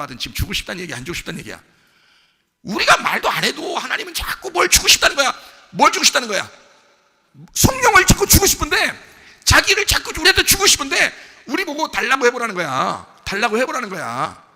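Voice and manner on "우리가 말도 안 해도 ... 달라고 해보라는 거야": loud, high preaching burst; full-voiced pulpit climax; preaching at full force. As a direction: drive it home to the congregation. Preacher peaks in loud exhortation.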